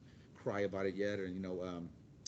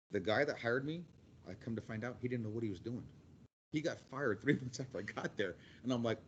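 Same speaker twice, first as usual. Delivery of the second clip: ordinary talk, nothing marked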